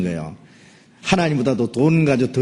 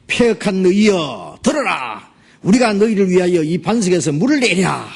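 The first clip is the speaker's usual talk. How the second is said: Moses rebuking; 7 semitones higher than usual. Acted. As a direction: thunder it at a rebellious crowd. Moses rebukes the people angrily.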